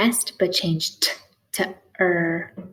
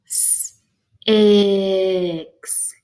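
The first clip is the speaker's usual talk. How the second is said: S hissed alone, then a long stretched ix; said slow; 4 dB louder than usual. Shown as a demonstration. Teacher helping find the middle sound, said slow. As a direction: stretch out S, ix in two parts. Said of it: "You can say it slow like that".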